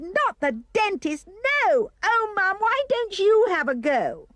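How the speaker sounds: high, pleading shout to mum; shouted protest. A narrator's reading. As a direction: protest wildly with the pitch way up. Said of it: "I shouted".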